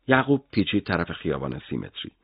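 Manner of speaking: ordinary delivery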